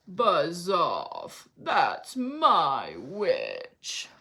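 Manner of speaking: terrible beast voice. A narrator's reading. Beast warning off the dragon, slow growling voice. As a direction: growl it out like a monster. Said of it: "its terrible voice".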